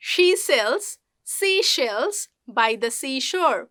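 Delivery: very slow "She sells", SH and S kept distinct; careful, spaced-out model of "seashore"; S and SH drill. Shown as a demonstration. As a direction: separate the S and SH in "seashells". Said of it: "you will improve your sa".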